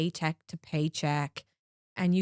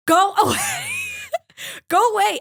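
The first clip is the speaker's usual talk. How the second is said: high, loud shriek to go away; panicked shout; 9 dB louder than usual. Retold or imitated. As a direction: yell it in mock panic, half laughing. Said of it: "and I was like".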